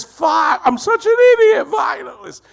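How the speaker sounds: high, loud imitation of a man cursing himself; mock-yell in exasperated regret; self-berating shout. Retold cabbie berating himself.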